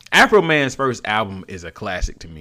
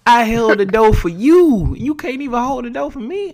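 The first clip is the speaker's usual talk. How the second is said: mock indignant rant; 12 semitones higher, 4 dB louder than usual. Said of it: "I'd be like".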